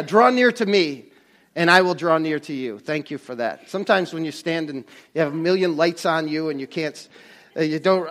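ordinary delivery

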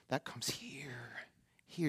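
very slow, near-whispered preaching; hushed emphasis. Real, not acted. Preacher showing where God's pleasure comes from, hushed. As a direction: drop to a soft, drawn-out hush. Hushed point about the heart.

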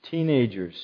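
ordinary delivery

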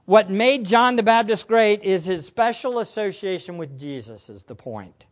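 usual speaking voice

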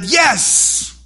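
high, loud joyful yes; triumphant shout. Pretend. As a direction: cry out in victory. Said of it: "maybe they shouted".

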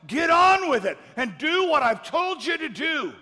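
high, loud shouted rebuke; stern divine command. Acted. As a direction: bark the order like an impatient father. God's stern command, preached.

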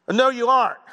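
loud, high, stern father's command; stern dad voice. Retold or imitated. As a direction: bark it like a strict dad. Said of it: "It was a very stern dad voice".

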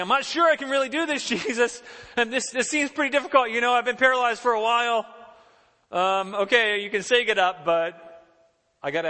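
high, loud, protesting mock hesitation; reluctant doubter. Paralyzed man answering Jesus's command to get up, doubtful protest.

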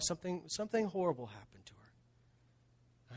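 hushed whisper, discreet; whispered confidence. Real, not acted. Pastor confiding a church member's pain, whispered. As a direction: drop to a whisper as if sharing a secret. Confiding about a wronged woman.

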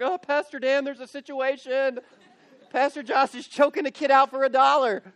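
put-on alarmed kids. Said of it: "Y'all will be like".

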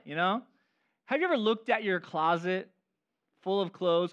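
usual speaking voice

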